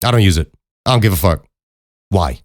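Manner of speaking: ordinary talk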